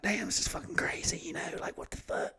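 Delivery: hushed aside in disbelief; stunned whisper. Retold or imitated. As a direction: whisper it so the court won't hear. Stunned aside while sitting in court.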